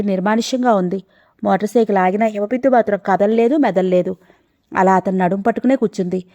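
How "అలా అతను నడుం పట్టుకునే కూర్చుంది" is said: ordinary delivery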